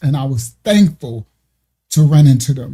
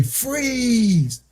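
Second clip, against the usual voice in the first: imitated police order; 7 semitones higher, 4 dB quieter than usual. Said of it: "All you hear is them say".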